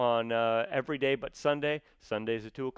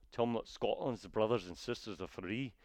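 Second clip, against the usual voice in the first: put-on Scottish accent, soft and low-key; Scottish brogue; 3 semitones lower, 8 dB quieter than usual. Shown as a demonstration. Actor showing why a brogue fails, mock Scottish accent. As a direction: do a quick Scottish burr. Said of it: "the Scottish brogue".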